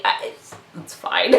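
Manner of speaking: breathy whispered aside, trailing off. Real, not acted. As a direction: whisper it to yourself, a little sheepish. Embarrassed aside after joke.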